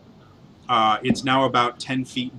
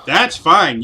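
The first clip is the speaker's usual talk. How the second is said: ordinary talk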